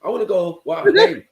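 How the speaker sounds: loud, high disbelief rising to a cry; shocked protest. Retold or imitated. Told of his new school, a loud high outraged kid. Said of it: "I was like".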